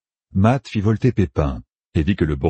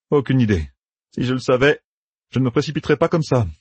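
Matt's voice; 7 semitones higher than usual. A narrator's reading. Matt answers Thom.

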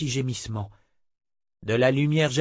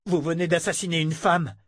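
angry shout; 7 semitones higher than usual. Acted. Richard accuses Verna of murder, shouting angrily. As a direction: hurl the accusation at her. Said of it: "cria-t-il".

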